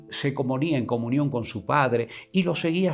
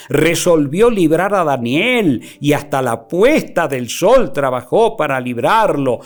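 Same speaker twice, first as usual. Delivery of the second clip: loud, declaiming Bible reading; raised preacher reading; preaching scripture; 5 semitones higher, 6 dB louder than usual. Acted. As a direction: proclaim the verses to the congregation. Preacher reads scripture aloud.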